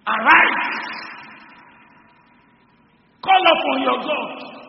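loud, high ringing order; captain shouting Jonah awake; shouted command. Acted. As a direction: wake him with a roar.

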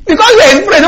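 pitched high, half crying; mock pleading friend. Preacher mimicking a friend who begs you to back his lie. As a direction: playact the whining friend.

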